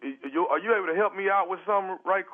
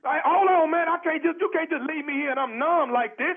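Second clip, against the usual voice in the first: protesting; 8 semitones higher than usual. Acted. Caller protests being abandoned.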